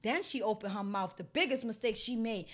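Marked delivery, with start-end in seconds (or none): none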